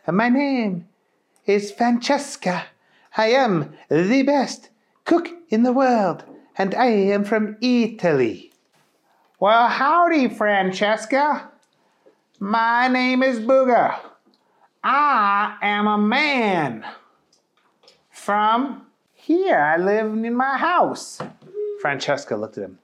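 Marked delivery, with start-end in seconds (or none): 0.1-8.5 put-on high, proud Italian accent. A narrator's reading. Francesca introduces herself in an Italian accent. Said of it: "very strange accents". 9.4-21.2 high, slow goofy bumpkin; goofy country voice. A narrator's reading. Booger greets Francesca, high and slow.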